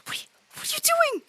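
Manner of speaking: high, breathy, incredulous whisper; stunned hissed question. Acted. Pontius asks the riders what they're doing, high whisper. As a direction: squeak it under your breath. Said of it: "He goes".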